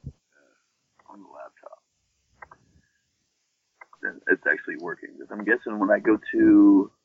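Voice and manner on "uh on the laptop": barely voiced under breath; whispered aside. Real, not acted. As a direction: mutter it to yourself. Muttered aside at the mic.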